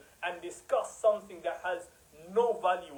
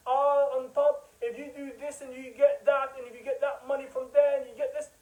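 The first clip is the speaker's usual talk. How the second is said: mock rebuking scholar; 4 semitones higher than usual. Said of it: "But you will rebuke".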